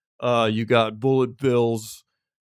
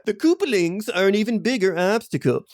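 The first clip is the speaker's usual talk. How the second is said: high, mock-grave complaining neighbour; hammed-up annoyed neighbour; Nextdoor poster voice; 8 semitones higher than usual. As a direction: huff it like a local online complainer. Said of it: "someone on Nextdoor saying it".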